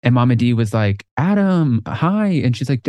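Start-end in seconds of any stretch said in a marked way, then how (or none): 1.2-2.3 high, sing-out hello; delighted greeting. Retold or imitated. Mama D spotting someone she remembers, delighted.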